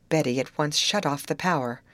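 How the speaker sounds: ordinary delivery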